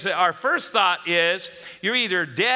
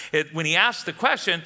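usual speaking voice

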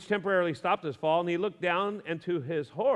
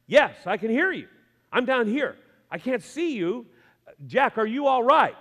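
louder, higher shout back up; desperate answer; 5 semitones higher, 5 dB louder than usual. Acted. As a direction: shout up from the branch. Jack answering, dangling.

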